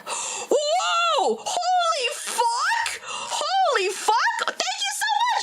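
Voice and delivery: shrieking, laughing high shout of thanks; real excited shout. Real, not acted. Streamer thanking a viewer for a gift, high laughing shout. Big donation or gift reaction.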